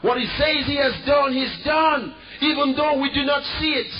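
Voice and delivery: preaching at full force. Real, not acted. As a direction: shout the faith home.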